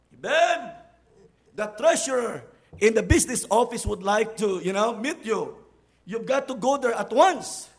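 loud call through the door; urgent call. Retold or imitated. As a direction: summon him urgently. Someone calling at the door.

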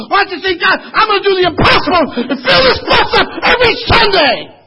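loud, high, crying peak; tearful shouting climax; full-force preaching. Acted. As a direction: cry the vow out to the rafters.

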